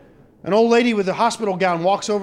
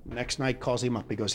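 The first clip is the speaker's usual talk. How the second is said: ordinary talk, nothing marked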